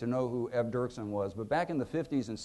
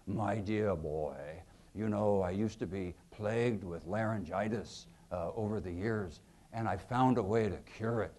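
Dirksen's voice; 6 semitones lower, 6 dB quieter than usual. Retold or imitated. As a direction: purr it like an old senator giving advice. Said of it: "And Dirksen tells Kennedy".

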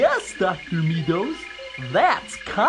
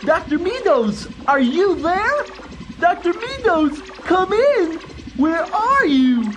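higher, searching call; calling out anxiously; 5 semitones higher than usual. Acted. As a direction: call for someone who isn't answering. Calling doctor who does not answer.

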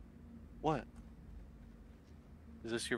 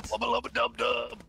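cartoon catchphrase voice; 11 semitones higher, 3 dB louder than usual. Catchphrase impression in banter.

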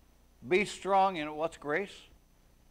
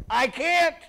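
high, loud cry of giving up; helpless admission; 13 semitones higher, 11 dB louder than usual. Retold or imitated. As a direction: wail it like someone at the end of their rope. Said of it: "get to the point where we say".

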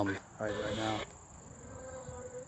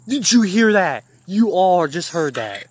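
stunned shout; 7 semitones higher, 13 dB louder, slower than usual. Real, not acted. Shocked at a heard voice.

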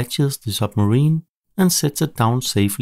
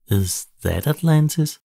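in wonder; 6 semitones higher than usual. A narrator's reading. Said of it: "says T K thirteen in wonder".

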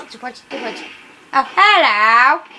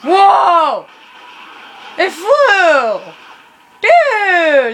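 excited shout; 4 semitones higher, 6 dB louder, much slower than usual. Real, not acted. Car flies after explosion.

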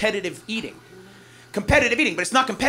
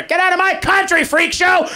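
mock bigot shout; 10 semitones higher, 5 dB louder, slower than usual. Comic mocking a bigot, yelling at imagined immigrants. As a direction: bellow it like a cartoon bigot.

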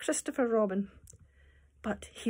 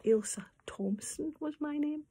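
excited hushed whisper; 6 semitones higher, 4 dB quieter than usual. Acted. Teacher whispering about her childhood book. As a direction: share a little secret. Shows name inside old book.

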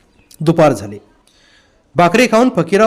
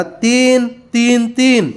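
shouting; 5 semitones higher, much slower than usual. A narrator's reading. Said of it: "साधू ओरडला".

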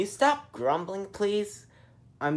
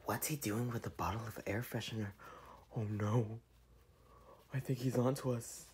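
whispering; 8 semitones lower, 18 dB quieter than usual. Acted. Spying from hiding.

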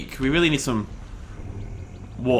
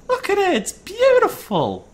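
awed; slower than usual. Real, not acted. Awed by the view as giant.

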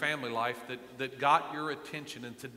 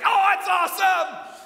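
loud, high stadium shout; crowd cheer; 12 dB louder, slower than usual. Retold or imitated. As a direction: yell it like a fan in the stands. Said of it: "everybody in the stands is like".

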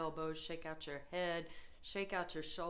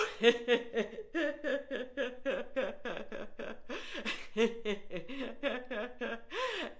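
long rolling laughing fit; phone-call laughter; 5 semitones higher than usual. Shown as a demonstration. Yoga leader pretending to enjoy a funny phone call, long laugh. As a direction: laugh in waves as if amused by a caller. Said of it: "all while laughing".

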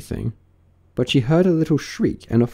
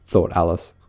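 ordinary delivery

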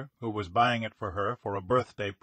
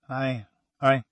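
nervous halting stammer; 4 dB louder than usual. A narrator's reading. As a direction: trip over the first word. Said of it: "he stammered".

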